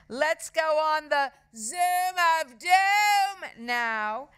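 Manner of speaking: high, loud, drawn-out boast; showing-off girl's holler; loudly. A narrator's reading. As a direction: announce it slowly for all to hear. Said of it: "said Margaret loudly".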